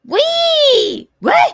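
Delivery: very high, loud whooping squeals; playful squeal. Pretend. Host squealing as the check-in call starts, loud high squeals.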